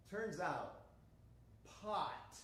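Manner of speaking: ordinary talk, nothing marked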